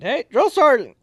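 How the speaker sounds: high, loud, friendly hail; bright shouted greeting; cheery call. Retold or imitated. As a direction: call out to him across the room. Calling out cheerfully to sergeant.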